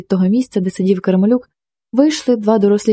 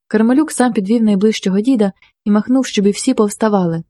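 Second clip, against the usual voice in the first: usual speaking voice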